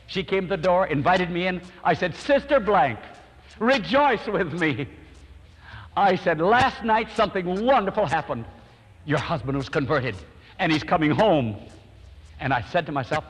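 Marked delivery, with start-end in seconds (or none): 2.1-11.6 wife hears of her husband's conversion, jubilant; joyful announcement. Retold or imitated. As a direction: announce the good news with delight. Announcing good news to wife.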